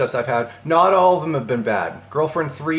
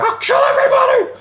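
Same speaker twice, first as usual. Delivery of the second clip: very high, loud strained shriek; mock scream; 8 dB louder than usual. Guy screaming behind the laughing woman, imitated high scream. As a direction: scream it like a raving man in the background. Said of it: "like, screaming".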